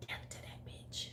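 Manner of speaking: whisper. Retold or imitated. Repeats the line as a whisper.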